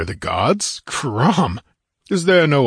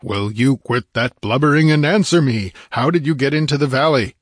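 impatient; 6 semitones higher, 3 dB louder than usual. A narrator's reading. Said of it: "with an impatient imprecation".

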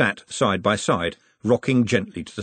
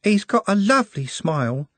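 put-on a dreamy, higher girl voice; wistful; 9 semitones higher than usual. A narrator's reading. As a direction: sigh it out with a crush. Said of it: "with a wistfulness".

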